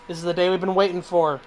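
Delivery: ordinary talk, nothing marked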